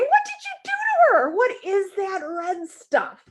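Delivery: very high, outraged mother; alarmed mother. Acted. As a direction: demand answers, shocked and high. Missus Lane scolding the sitter.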